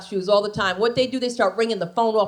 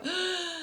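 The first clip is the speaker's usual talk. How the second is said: shocked gasp; 8 semitones higher, 11 dB quieter than usual. Retold or imitated. Mother-in-law gasps.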